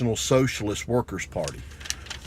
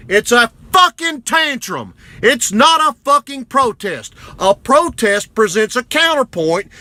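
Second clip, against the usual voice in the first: furious shouted rant; 10 semitones higher, 7 dB louder than usual. Real, not acted. Man berating Antifa protesters over their tantrums, furious shouting. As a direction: yell it at them, hammering every curse.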